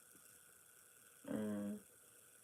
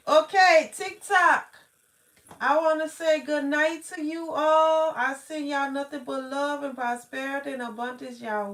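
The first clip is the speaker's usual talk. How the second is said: much louder and higher, calling out to the chat; excited sign-off; 4 semitones higher, 13 dB louder than usual. Real, not acted. Saying good night to TikTok viewers, loud and excited. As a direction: beam good night to everyone, loud and bright-voiced.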